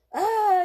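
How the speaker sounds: loud, high scream; mom screams. Acted. Mom screaming around the kitchen table. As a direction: shriek in fright. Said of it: "yelled Donovan's mom".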